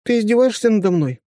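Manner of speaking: indignant, hands on hips; indignant young woman. A narrator's reading. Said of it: "демонстративно упёрла кулаки в бёдра".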